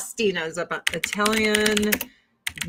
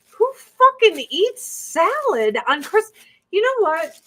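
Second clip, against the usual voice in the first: much higher and louder, outraged disbelief; incredulous rant; 11 semitones higher, 5 dB louder than usual. Real, not acted. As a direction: blurt it like the idea offends you. Ranting about salad at Christmas.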